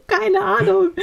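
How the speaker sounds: high teary wail; crying. Retold or imitated. Telling her boyfriend she doesn't know, high tearful wail. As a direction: sob the words out helplessly. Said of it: "Voll am Heulen".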